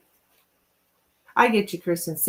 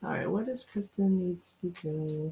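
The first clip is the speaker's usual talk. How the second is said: softer, quietly working out the words; soft murmured wording; 8 dB quieter than usual. Shown as a demonstration. Reader quietly rephrasing the question for the cards. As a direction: say it quietly, thinking aloud. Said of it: "What is wrong with me?".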